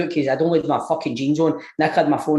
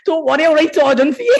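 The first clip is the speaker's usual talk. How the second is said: laughing; 11 semitones higher, 5 dB louder, faster than usual. Retold or imitated. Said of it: "Greg Grado's like".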